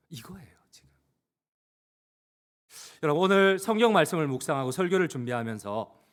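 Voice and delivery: usual speaking voice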